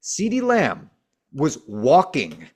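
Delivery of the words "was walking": slow, drawn out disbelief; slow emphasis. Real, not acted. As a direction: drag it out to show the laziness. Stressing the lazy effort.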